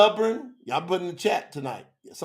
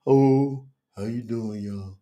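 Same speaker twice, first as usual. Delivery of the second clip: limp greeting; 7 semitones lower than usual. Shown as a demonstration. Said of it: "I am never".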